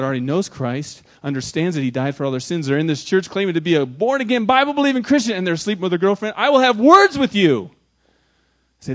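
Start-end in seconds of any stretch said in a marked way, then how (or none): 6.4-7.7 loud, high, stern warning; stern rebuke. Acted. Pastor warning a church member, raised stern voice.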